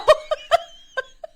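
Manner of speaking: real high burst of laughter. Real, not acted. Laughing at own joke.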